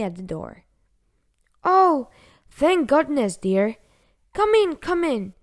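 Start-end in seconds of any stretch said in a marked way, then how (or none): 1.6-5.3 high, louder welcome with relief; relieved grandma. A narrator's reading. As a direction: call out like a relieved granny at the door.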